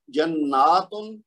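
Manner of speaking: slow "جناتن" with long naa and sounded tun; plural form. Shown as a demonstration. Teacher reads the screen example, long naa with tun. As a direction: stretch the middle of "جناتن", then clear t-u-n. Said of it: "اس کی جمع ہے جناتن".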